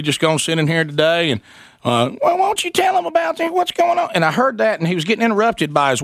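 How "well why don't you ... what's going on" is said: mock wife voice. Impersonating radio host's wife.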